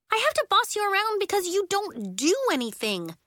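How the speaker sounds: put-on high, indignant child's retort; exasperated, high-pitched scolding; quarrelling. A narrator's reading. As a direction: snap back at your useless partner. Two girls in acted quarrel.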